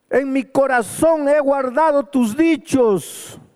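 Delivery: high, loud pulpit proclamation; full-force preaching. Acted. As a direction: proclaim it to the back row. Preaching climax about Christ. (predicación a toda voz, aguda y fuerte)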